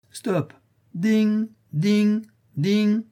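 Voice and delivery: high, ringing bell imitation; a boy playing at captain and bell; play steamboat captain. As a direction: call the order, then chime like a ship's bell. Plays captain and ship's bell.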